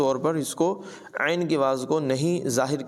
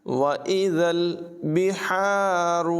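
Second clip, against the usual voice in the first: slow chanted tajweed recitation; Quran recitation; 4 semitones higher, much slower than usual. Shown as a demonstration. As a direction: recite it melodically, drawing out the vowels. (धीमी, सुरीली क़ुरान तिलावत)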